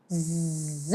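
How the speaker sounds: ز buzzed very softly through teeth; quiet z buzz. Shown as a demonstration. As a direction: breathe ز out gently. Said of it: "تو ہم پھر سے پڑھتے ہیں".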